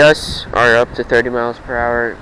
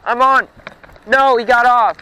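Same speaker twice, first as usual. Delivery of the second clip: excited; 10 semitones higher, slower than usual. Real, not acted. Hooking a fish, excited yell.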